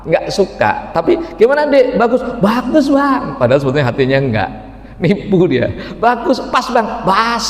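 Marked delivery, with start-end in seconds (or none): 1.3-2.2 high voiced husband asking his wife. Husband asking wife about new shoes, high eager voice. 2.4-3.3 high mock wife voice; wife's reply. Wife fibbing to husband about shoes, high sweet voice. 6.0-7.4 high mock wife voice; wife's reply. Wife fibbing to husband about shoes, high sweet voice.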